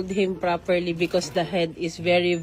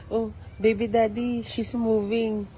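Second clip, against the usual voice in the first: ordinary delivery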